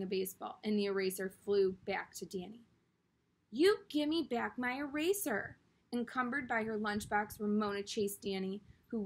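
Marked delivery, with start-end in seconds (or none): 3.5-5.6 loud, higher demanding yell; angry yell. A narrator's reading. Ramona yelling at Danny for her eraser, demanding. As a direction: shout at the boy to give it back.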